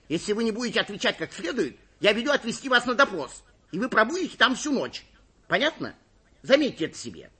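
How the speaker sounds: high-pitched, forceful police warning; stern threat. A narrator's reading. Police chief threatens the suspect. (строгая угроза на повышенных тонах)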